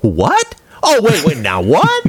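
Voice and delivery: repeated high confused what; baffled viewer. Retold or imitated. Mocking a confused viewer of Postal. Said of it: "Postal is like".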